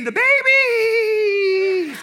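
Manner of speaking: high, loud, long-drawn demonic wail; eerie high cry, hugely drawn out; creepy possessed voice. Retold or imitated. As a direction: stretch it out like someone possessed. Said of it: "then she says it again".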